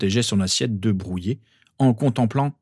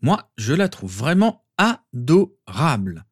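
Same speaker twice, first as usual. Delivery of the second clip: ADORABLE drawn out syllable by syllable; slow, high, swooping emphasis on vraiment adorable; heavy insistence; 5 semitones higher, 3 dB louder, slower than usual. A narrator's reading. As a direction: lay it on thick, stretching adorable into separate beats. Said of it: "insista lourdement Harry".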